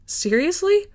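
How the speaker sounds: startled disbelief. A narrator's reading. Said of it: "barked a startled laugh".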